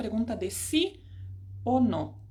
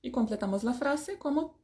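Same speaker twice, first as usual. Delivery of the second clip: ordinary talk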